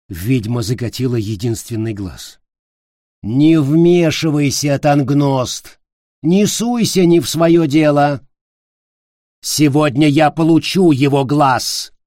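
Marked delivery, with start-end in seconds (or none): 3.2-11.9 louder, higher furious witch; angry shout. Acted. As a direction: snap at him to back off.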